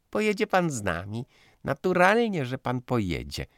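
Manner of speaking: put-on high insistent girlish cry; excited exclaiming. Daughter pressing Józef to come, high and excited. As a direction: insist gaily that he's coming. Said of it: "zawołała panna Rosiczka".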